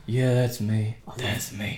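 low-key, quiet character voice; put-on Vietnam vet. Vet answering a stranger by trucks, quiet put-on veteran voice.

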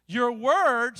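loud, drawn-out proclamation; deliberate scripture reading; slow emphatic reading. Real, not acted. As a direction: stretch the words out and land them hard. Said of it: "I'm gonna read that slow".

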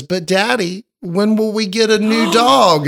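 put-on high, pitiful little-girl voice; crying daughter. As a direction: imitate a sad child pleading with Daddy. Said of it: "and she's crying".

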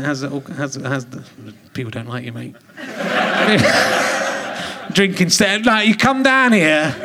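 ordinary talk, nothing marked

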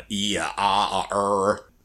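stammering filler sounds; scatterbrained hesitation. Shown as a demonstration. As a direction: fumble for words, mock flustered. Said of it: "I'm all scatterbrained".

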